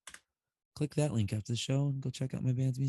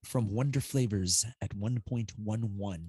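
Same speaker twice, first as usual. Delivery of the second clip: usual speaking voice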